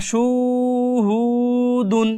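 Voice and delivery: slow সুহুদুন with the opening syllable wrongly lengthened; wrong reading. Shown as a demonstration. Teacher showing another common wrong reading, slowly drawn out. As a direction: stretch সুহুদুন at the start, the mistaken way. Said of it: "অনেকে ভুল করে টানে".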